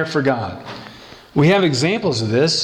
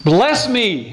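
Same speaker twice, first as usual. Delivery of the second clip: passionate plea; 5 semitones higher than usual. Acted. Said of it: "Jabez's passion, he asked God".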